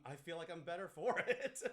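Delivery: laughing through the words. Real, not acted. Laughs while saying it.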